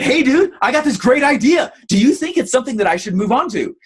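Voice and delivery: high, bright-eyed pitch to a mentor; eager question. Retold or imitated. Texting a guru from the delivery room, eager. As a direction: ask like a keen young student.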